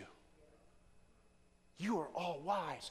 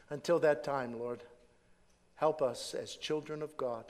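closing prayer; 3 semitones lower than usual. Acted. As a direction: pray gently for the church.

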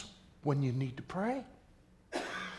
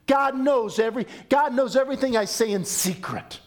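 rising preacher emphasis; 8 semitones higher, 5 dB louder than usual. Real, not acted.

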